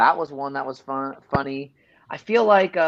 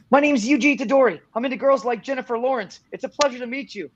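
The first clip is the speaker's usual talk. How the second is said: higher, eager anime hero voice; Yuji voice; 10 semitones higher than usual. Shown as a demonstration. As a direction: play the upbeat anime teen. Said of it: "I will say, um, a line as Yuji".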